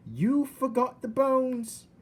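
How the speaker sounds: put-on high, loud and slow simple girl; Mig's loud voice. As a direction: blurt it out slowly.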